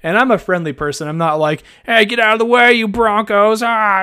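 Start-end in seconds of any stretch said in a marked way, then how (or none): 1.9-4.0 mock tough-guy yell clearing the way; mock aggressive shout. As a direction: shove past them like a jerk, half-joking. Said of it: "I'm not, I'm not like that".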